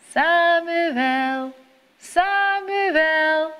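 high, drawn-out calling voice from afar; God calling. A narrator's reading. God calls sleeping Samuel in the night, distant and sung. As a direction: call the boy's name like a distant sung summons. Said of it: "hoort Samuel weer roepen".

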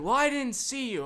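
mock defensive driver. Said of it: "she's like".

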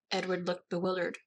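plain reading voice

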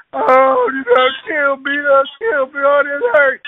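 high, loud pained wailing; wounded wail. Retold or imitated. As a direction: cry out like you've been shot. Shot man on the ground.

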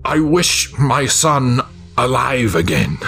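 reluctant, trembling father; fearful wish. A narrator's reading. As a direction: utter it in dread, with pauses. Frightened father makes the dreaded wish.

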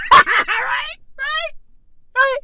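high, squeaky rhetorical right; squeaky mock question. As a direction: pitch it way up, mocking. Exaggerated questioning.

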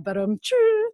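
high, loud imitated drum sting; mock rimshot. Podcaster capping a bad barista pun, mock rimshot. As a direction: sing out a joke-ending drum roll and cymbal.

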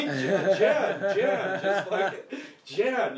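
calling her name through laughter; laughing pleas. Retold or imitated. Hiding behind girlfriend, laughing.

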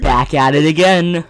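loud, slow, drawn-out hype; hyped-up shout. Pretend. Returning guest announcing himself to the host, loud and hyped. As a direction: stretch it out loud and proud.

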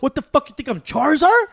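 high, loud mock outrage; mock indignant fighter. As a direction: toss it back like an offended fighter. Mocking fighter's reply.

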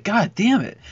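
high, exasperated cry of annoyance; a retold curse at useless teammates, pitched high; mock frustrated outburst. Player fed up with teammates at kickball, high exasperated curse. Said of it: "And I'm like".

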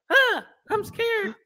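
scared tarantula voice. Retold or imitated. Friend voicing the tarantula again, slow timid mock fright. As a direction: play the spider, cowering and squeaky. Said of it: "the body language sort of like".

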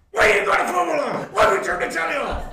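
high, shouted, half laughing; mock angry shout. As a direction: yell like the outraged mate, cracking up. Teammate shouting about the telly.

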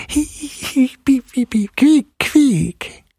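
high squeaky mouse giggles; squeaking giggle. A narrator's reading. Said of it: "piepste und quiekte ihr ins Ohr".

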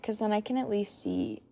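ordinary talk

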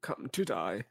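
high, then hushed menacing villain voice; Voldemort-style taunt, dropping to a hiss; Ralph Fiennes impression. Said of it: "Dude, Ralph Fiennes, man".

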